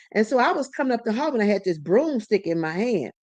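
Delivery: normal talking voice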